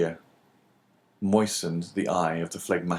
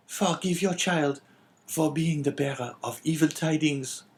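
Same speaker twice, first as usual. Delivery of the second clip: put-on high, pleading girl's voice; a daughter begging her father's pardon; tearful young girl; 8 semitones higher than usual. A narrator's reading. Daughter pleads with father, high and tearful.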